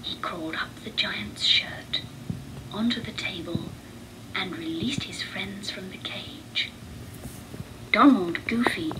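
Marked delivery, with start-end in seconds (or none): none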